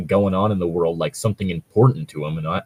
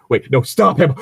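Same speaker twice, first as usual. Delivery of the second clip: alarmed shout; 5 semitones higher, 6 dB louder than usual. Retold or imitated. Acted party member calling out.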